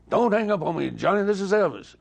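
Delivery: imitating an exasperated caller; insistent retold plea. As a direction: insist on being believed, voice jumping up. Elvis calling back after hang-up.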